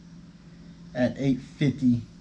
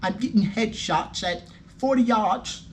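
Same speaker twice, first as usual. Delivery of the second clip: higher-pitched put-on English chap; hammed-up English accent, pitched up; mock English accent; 5 semitones higher than usual. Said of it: "That's my English accent for y'all".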